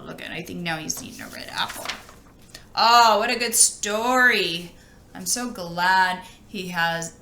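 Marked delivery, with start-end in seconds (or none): none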